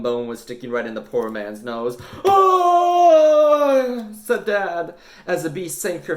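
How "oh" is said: pained cry. Acted. Dad bitten by cat skeleton.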